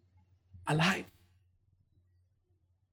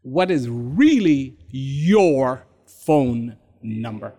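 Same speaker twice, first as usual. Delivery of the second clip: slow and low, word by word; deliberate, drawn-out probing question; slow, weighty question; 10 semitones lower, slower than usual. Shown as a demonstration. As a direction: space out each word with weight. Question re-put slowly for weight.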